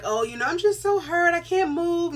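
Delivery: high whiny self-pity, hammed up; mock moping. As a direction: play the sorry invalid. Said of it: "moping, like".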